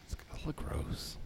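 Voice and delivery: hushed whisper. Acted. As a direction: breathe it out unvoiced. Whispered joke about orphans.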